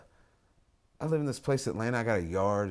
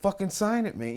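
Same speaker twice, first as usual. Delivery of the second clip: pitched-up mock demand; heated demand; 7 semitones higher than usual. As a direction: push him hard to sign. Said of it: "then it gets a little more heated".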